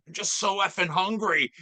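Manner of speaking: Brenda voice. Retold or imitated. Quoting Brenda's hungry line.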